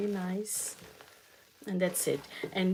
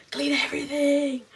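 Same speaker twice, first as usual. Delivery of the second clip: high, louder panicked order; freaking out; 8 semitones higher, 5 dB louder than usual. Retold or imitated. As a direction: blurt it in a rising panic as the sister. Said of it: "completely freaking out".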